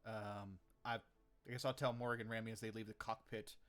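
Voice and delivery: ordinary talk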